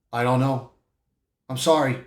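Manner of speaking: low, soft, helpless admission; subdued apology. Retold or imitated. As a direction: apologize quietly, voice dropped. Reed admits he cannot promise safety.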